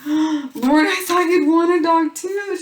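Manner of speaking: very high, loud, put-on pleading voice; pleading to Lauren. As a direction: squeak it out like a hurt mom begging.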